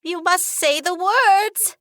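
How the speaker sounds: very high, slow squeaky voice; squeak. A narrator's reading. Frightened genie squeaking instructions to the heroine. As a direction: squeak it out in fear. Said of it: "Shadi squeaks".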